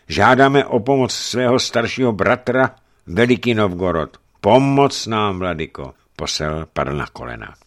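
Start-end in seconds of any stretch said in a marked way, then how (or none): 0.1-5.9 raised, loud appeal; urgent pleading. A narrator's reading. Pskov envoy begging the archbishop for help, pleading. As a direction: beg for help with force.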